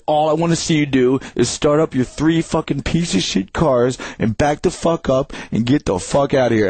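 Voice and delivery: mildly acted angry eviction; Hane. Neighbor ordering three cars from the gate, mildly imitated anger. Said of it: "And then he's like".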